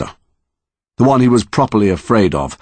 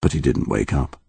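ordinary delivery

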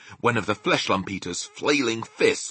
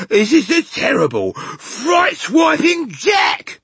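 building from raised voice to high, loud screams; terrified giant bellowing, ever more frantic; panicked bellowing; 10 semitones higher, 6 dB louder, slower than usual. A narrator's reading. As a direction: wail louder and higher with each complaint. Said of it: "bellowed the flesh lump eater".

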